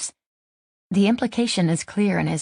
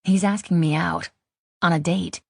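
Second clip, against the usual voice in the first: ordinary delivery, nothing marked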